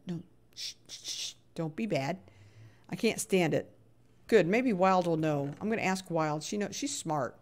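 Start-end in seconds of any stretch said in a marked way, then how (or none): 0.1-2.2 soft shushing; hushed scolding. Real, not acted. Telling Jackson not to be bad, hushed scolding.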